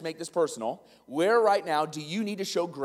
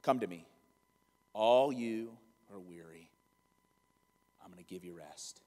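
gentle Jesus; 12 semitones lower, 8 dB quieter than usual. Retold or imitated. Preacher voicing Jesus calling the weary, soft and tender. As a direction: lower and hushed, welcoming the weary. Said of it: "he's like".

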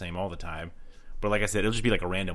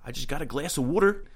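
put-on Baltimore accent; 6 semitones higher than usual. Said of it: "a Baltimore accent when they're like".

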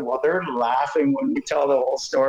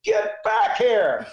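a sharp, much higher, drawn-out yell across the field; retold yell; 8 semitones higher, much slower than usual. Supervisor hollering at the kid, a laughing shout. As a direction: call the kid back, laughing through a shout. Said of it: "I hear Don Gilbert just yell".

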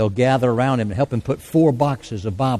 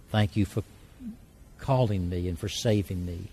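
led prayer. Acted. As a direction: lead the congregation gently in prayer. Leads a sinner's prayer.

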